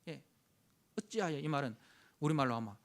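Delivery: ordinary talk, nothing marked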